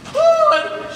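very high, loud stage cry. Acted. Writer voices Lady Gwen's line, high melodramatic cry. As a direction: wail the name out theatrically. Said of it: "she cries".

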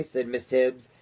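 ordinary delivery, nothing marked

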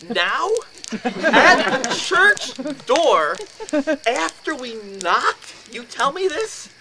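loud, high, stretched-out disbelief; incredulous outburst. Retold or imitated. Man to friend confessing disbelief at church door, incredulous outburst. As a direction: hammer each phrase slowly in outrage.

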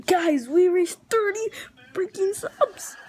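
high-pitched, overwhelmed glee; real giddy squeal, drawing out the last words; fanboy excitement. Real, not acted. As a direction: gush like a thrilled fanboy, voice climbing. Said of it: "I'm gonna go fanboy right here".

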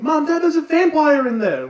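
put-on high, loud kid shouting the news; alarmed kid. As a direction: blurt it out high to the parents. Kids alarmed to parents.

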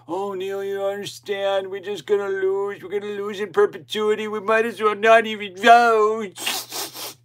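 high mock whine of a quitter, slowing at the end; mocking defeatist commenter. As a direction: mimic a hopeless commenter, sneering. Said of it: "their comments about how".

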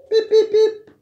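very high, loud beep noises. Acted. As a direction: squeak beeps like a toy. Imitating toy beeping.

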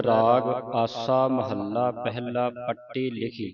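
slow, measured chanting of the title; slow recitation. Shown as a demonstration. Teacher reciting scripture slowly for a student. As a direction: recite each word slowly, drawn out. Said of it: "ਇਹਨੂੰ ਪੱਟੀ ਅਧਿਕ ਲਾ ਕੇ ਬੋਲਣਾ ਹੈ।".

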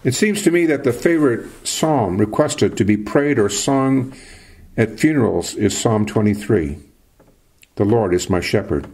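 normal talking voice

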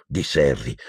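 ordinary delivery

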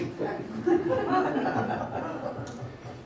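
breathy audience-style laugh; real laugh. Real, not acted. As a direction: laugh out at the joke. Joke about large insects.

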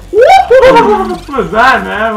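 very high, very slow, loud; high stretched squeal. Real, not acted. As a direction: drag it out in a squeal. Mimicking the odd sound.

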